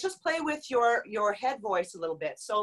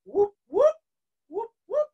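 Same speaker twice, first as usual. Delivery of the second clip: high rising falsetto whoops; falsetto siren whoop; 8 semitones higher, 7 dB louder, slower than usual. Shown as a demonstration. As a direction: slide up like a siren. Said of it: "For the men, if it's in your falsetto".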